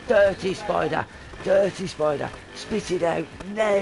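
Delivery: high, loud imitation of a frantic little girl; mock tantrum, last word stretched; child going mental. Dad imitating daughter scolding him, frantic high shriek. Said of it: "mental at me".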